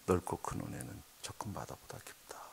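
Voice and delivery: breathless whispered murmur of a hymn line. Retold or imitated. A crushed pastor murmuring the hymn to himself, whispered. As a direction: barely voice 넓고 큰 은혜는, a broken whisper. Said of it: "몸부림치면서 중얼거린 거예요".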